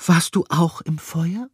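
a hint of a delicate mouse voice; mouse lady's question. The mouse asks gently.

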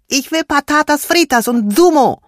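put-on high, loud childish demand; child shouting her wish to her mother; loud demanding cry. A narrator's reading. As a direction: shout it like a stubborn little girl. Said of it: "rief Lilian laut".

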